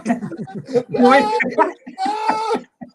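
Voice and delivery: high burst of real laughter; laughing. Real, not acted. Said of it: "reason why we're laughing".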